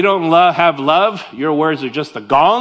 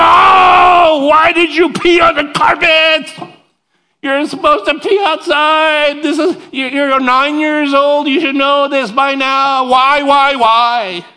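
much higher and loud, slow exasperated shouting; yelling at the dog; 9 semitones higher, 6 dB louder, slower than usual. Retold or imitated. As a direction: scold the dog at full volume. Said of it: "Full on".

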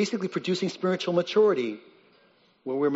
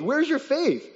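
high, sharp rebuke in Jesus' voice; Jesus rebuking; 13 semitones higher, slower than usual. Acted. Jesus rebukes his disciples after the storm, high sharp rebuke. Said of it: "a little faith that he rebukes them".

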